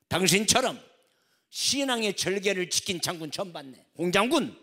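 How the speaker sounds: loud, high hearty greeting; hearty welcome. Acted. President welcoming and promoting the general: loud, hearty. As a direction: boom it out with delight.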